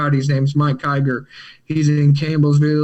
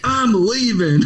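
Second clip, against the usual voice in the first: mad; 5 semitones higher, slower than usual. Retold or imitated. To his buddy after spilling his food, angrily quitting. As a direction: storm off in a huff. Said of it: "I was so mad that morning".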